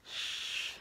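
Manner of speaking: long drawn-out "shh"; hushing hiss, acted out; imitated shush. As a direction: mimic a nurse shushing a patient. Imitates nurse shushing her.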